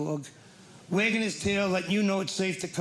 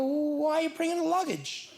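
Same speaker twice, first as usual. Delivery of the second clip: higher, slow disbelief; incredulous; 9 semitones higher than usual. Retold or imitated. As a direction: ask it in disbelief. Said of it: "I go".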